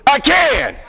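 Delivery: loud, high "I can"; rallying shout. Shown as a demonstration. Speaker leads the audience chant, loud rallying shout.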